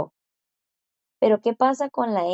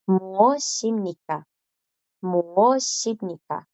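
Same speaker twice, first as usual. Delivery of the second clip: slow sounding out; much slower than usual. Shown as a demonstration. Teacher drills the formal ending slowly. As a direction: say "muoshimnika" slowly, joining s and i. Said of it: "entonces suenan juntas".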